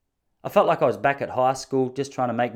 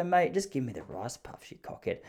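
muttered insult; 8 dB quieter than usual. Retold or imitated. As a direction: mutter it so he can't quite hear.

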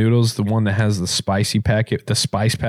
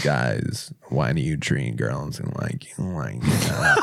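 deep, slow, soft sick voice; low preachy TikTok voice; TikTok guy voice; 10 semitones lower, 6 dB quieter, slower than usual. Pretend. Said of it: "can you please do it".

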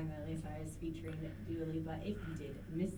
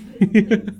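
laughing plea; 21 dB louder than usual. Real, not acted.

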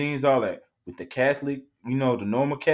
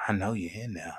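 slasher killer; 4 semitones lower, 7 dB quieter than usual. Pretend. Lady hunting him down.

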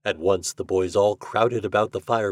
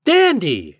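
high, loud exclamation; delighted shout; 10 semitones higher, 8 dB louder than usual. A narrator's reading. Toad hails Reddy's winning bite, shouting. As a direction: shout your approval. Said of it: "shouted Toad".

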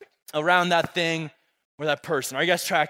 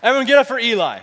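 loud, raised call for applause; rallying applause; 4 semitones higher, 7 dB louder, much faster than usual. Real, not acted. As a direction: rouse the room for a volunteer.

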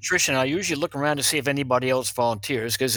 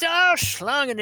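excited German officer; 10 semitones higher, slower than usual. Retold or imitated. German officer discovers bag of snakes.

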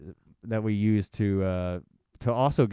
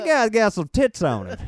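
much higher, louder through laughter; giggling hammed-up line; laughing mock line; 10 semitones higher, 7 dB louder than usual.

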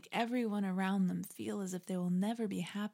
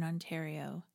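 ordinary talk, nothing marked

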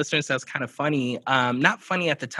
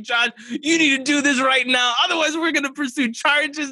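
mock legal threat; 12 semitones higher than usual. Host quoting his furious email to Josh, hammed-up high threat. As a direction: play it as an over-the-top threat. Retold cease and desist email.

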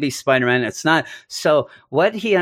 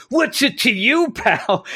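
high, hammed-up challenge to a friend; a hint of a comic-book bully; mock tough guy; 9 semitones higher than usual. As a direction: pick a fight in a cartoon tough-guy voice. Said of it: "Ned comes in".

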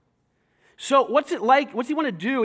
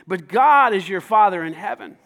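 ordinary delivery

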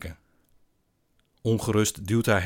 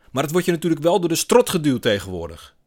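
loud, raised-pitch grumbling protest; indignant complaint; 8 semitones higher, 8 dB louder than usual. A narrator's reading. Man airing his grievance at the bar, indignant.

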